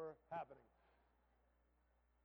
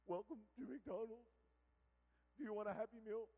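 hammed-up tearful greeting, higher pitch; imitated crying worker; 5 semitones higher, 4 dB louder than usual. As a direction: greet customers through mock sobs. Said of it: "she's crying".

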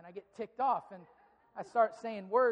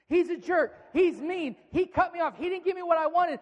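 loud, high, indignant ranting; angry ranting; 7 semitones higher, 11 dB louder than usual. Acted. Preacher voicing an angry driver, loud and indignant. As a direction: rant loudly about the jerk who cut you off.

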